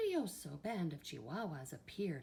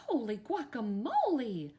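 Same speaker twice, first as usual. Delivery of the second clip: loud, higher-pitched astonished cry; exclaiming; 6 semitones higher, 7 dB louder than usual. A narrator's reading. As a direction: exclaim in startled amazement. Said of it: "exclaimed Skippy John Jones".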